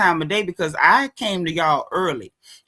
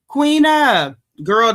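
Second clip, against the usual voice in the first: calling out; 8 dB louder, much slower than usual. Real, not acted. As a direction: call her name out loud. Calls out to a viewer.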